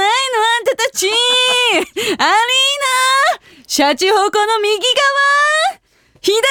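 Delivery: rile up the concert crowd like a pop diva. Retold or imitated. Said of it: "煽りの練習を[name]さんでやってみたいと思います".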